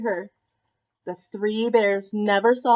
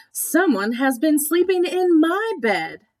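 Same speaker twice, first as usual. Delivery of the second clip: higher Mama Bear voice, a little louder; Mama Bear, lightly raised and higher; middle-sized voice; 6 semitones higher, 5 dB louder than usual. A narrator's reading. Mama Bear sees her bed used, higher voice. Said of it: "said in her middle-sized voice".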